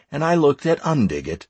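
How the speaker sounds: ordinary delivery, nothing marked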